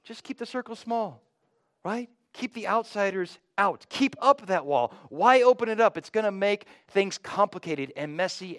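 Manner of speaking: lightly voiced know-it-all counsel; a hint of smug worldly reasoning; worldly wisdom voice. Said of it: "Worldly wisdom would say".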